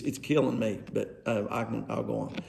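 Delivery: ordinary talk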